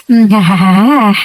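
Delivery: low, relishing mmm with eager yeses; a greedy, satisfied food hum; savoring hum. Acted. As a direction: lick your lips at the thought of the curry. Relishing the thought of prawns.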